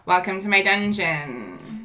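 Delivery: slow, soft villain welcome; a hint of a storybook villain; slow theatrical villain menace. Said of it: "Can I just do my evil villain speech now?".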